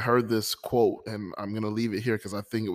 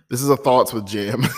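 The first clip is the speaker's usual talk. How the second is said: excited intro; 3 semitones higher, 9 dB louder than usual. Real, not acted. Show intro announcement.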